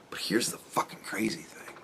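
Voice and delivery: hushed, breathy aside to the camera; whisper. Real, not acted. Vlogger whispering a confession to viewers, hushed. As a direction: lean in and whisper it.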